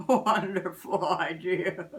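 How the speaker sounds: light, happy approval; pleased Santa. Acted. Santa heading to his sleigh, pleased.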